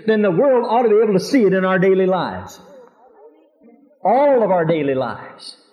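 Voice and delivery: rising preacher's voice, pressing hard; fervent preaching. Acted. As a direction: preach it with fire. Preaching at full force.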